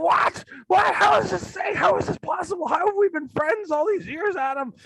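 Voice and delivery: very high, shrieking disbelief; mock aghast friends. A horror fan imitates friends aghast over Suspiria, high mock shriek. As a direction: shriek it like a betrayed drama queen.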